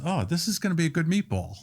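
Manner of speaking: a hint of the satisfied plant manager; plant manager, impressed. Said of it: "and he goes".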